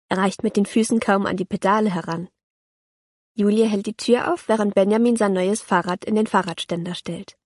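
ordinary delivery

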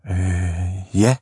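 put-on hesitant, nervous little boys. A narrator's reading. Small boys answering the big bully, nervous. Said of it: "sagde de små drenge nervøst".

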